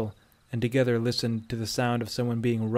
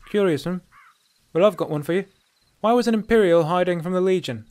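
higher, louder she-elf voice; raised, challenging bandit woman; probing dark elf woman; 7 semitones higher, 7 dB louder than usual. A narrator's reading. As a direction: turn the question back on the stranger.

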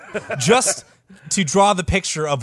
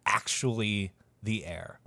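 whisper; 5 semitones lower, 11 dB quieter than usual. A narrator's reading. Dramatic question about the claimant.

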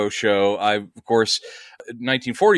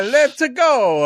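Mario voice; 12 semitones higher, 4 dB louder than usual. Retold or imitated. Mario catchphrase.

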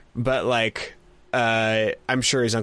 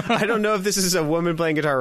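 higher, rushed, laughing through the words; flustered laughter; 8 semitones higher, faster than usual. Real, not acted. Correcting own slip to co-host, flustered and laughing. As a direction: laugh while scrambling to fix the muddle.

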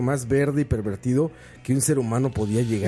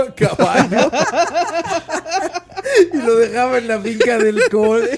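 higher, louder, through laughter; cracking up mid-sentence; laughing; 10 semitones higher, 5 dB louder than usual. Real, not acted. Laughing at own school story.

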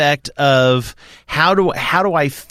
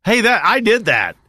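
higher-pitched, eager mock boast; retold bar boast; 8 semitones higher than usual. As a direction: pipe up as if claiming credit in a bar.

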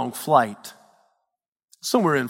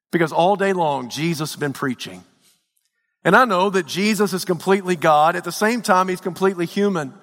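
usual speaking voice

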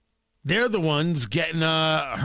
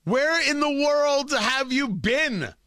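mock incredulous; 8 semitones higher than usual. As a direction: ask it as if they'd been asleep for a year. Mocking the headline's news.

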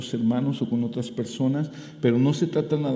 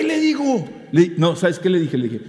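ordinary delivery

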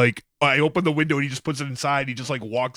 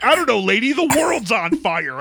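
high, louder fed-up retort; exasperated worker; 8 semitones higher, 4 dB louder than usual. Retold or imitated. Retelling the cashier's answer to a rude customer, higher and exasperated. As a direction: snap back, done with it all.

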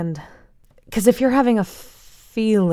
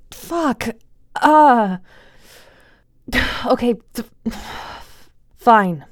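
near tears; 5 semitones higher, 4 dB louder, much slower than usual. Acted. Giving in, close to tears.

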